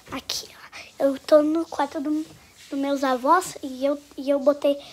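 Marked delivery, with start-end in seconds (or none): 0.1-1.1 hushed whisper, secret prank. Real, not acted. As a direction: breathe it with no voice. Hiding in grandparents' room.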